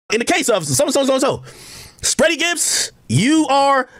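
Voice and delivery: mock TV host drama; Doctor Phil. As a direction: announce it like a daytime TV host with a paternity test. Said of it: "I could be the Doctor Phil".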